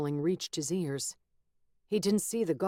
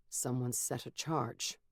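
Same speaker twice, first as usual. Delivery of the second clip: deliberate; 4 semitones lower, 5 dB quieter than usual. A narrator's reading. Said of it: "he said, deliberately".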